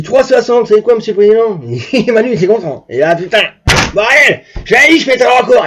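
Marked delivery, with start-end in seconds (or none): 0.0-1.6 louder, higher incredulous question; imitated amazed officer. Officer to the president about his speed, loud imitated amazement. 3.9-5.7 loud, higher-pitched boast; imitated triumphant shout. Manu to the officers after the record, loud imitated triumph.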